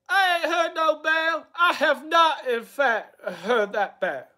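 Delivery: hammed-up high impression, slowing at the end; Ethan Ralph. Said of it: "His Ethan Ralph voice".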